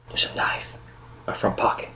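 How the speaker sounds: hushed, voiceless whisper; conspiratorial whispering; secretive whisper. Acted. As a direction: whisper it so captors miss it. Captive secretly instructing fellow captive.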